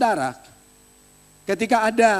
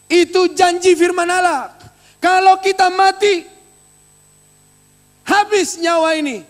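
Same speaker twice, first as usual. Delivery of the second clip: loud, high pulpit proclamation; preacher booming the promise at the church; fiery preaching; 8 semitones higher, 7 dB louder than usual. Acted. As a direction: thunder the promise to the congregation. Preacher rises to full force.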